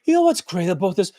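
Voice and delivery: imitated depressed speaker, sighing out after the phrase; sighing at the end. Shown as a demonstration. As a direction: say it, then let out a deflated sigh. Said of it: "sound like you're sighing at the end".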